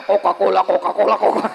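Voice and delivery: low rapid "Coca-Cola" chant; imitated gurgling sound. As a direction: gurgle the words like sloshing liquid. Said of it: "kencing nenek-nenek bagaimana?". (tiruan bunyi gemericik bernada rendah)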